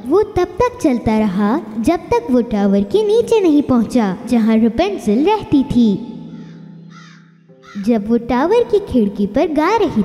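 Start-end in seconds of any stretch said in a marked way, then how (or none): none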